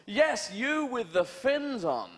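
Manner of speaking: high, loud jeering call; loud mocking taunt, slow and drawn out; taunting shout. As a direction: yell across the beach to taunt the sharks. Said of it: "We'd say".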